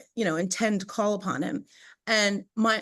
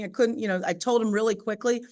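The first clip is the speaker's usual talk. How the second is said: ordinary talk, nothing marked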